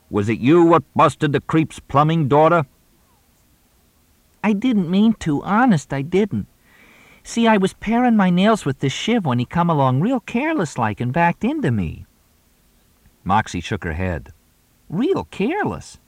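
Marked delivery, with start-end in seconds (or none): 0.1-2.6 high, loud tough-guy voice; gangster role. Acted. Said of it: "He's doing the role of Scanlon". 4.4-12.0 put-on very high, pleading girlish voice; innocent moll. Moxie plays the guilty moll. 14.9-16.0 very high sly echo; moll voice. Acted. Moxie echoes in role.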